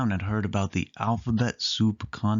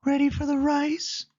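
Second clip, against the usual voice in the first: much higher, cartoon mouse voice; Minnie voice. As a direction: squeak it out as Minnie. Minnie asks about rice.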